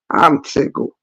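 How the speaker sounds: high laugh then whispered words; real tickled laugh. Real, not acted.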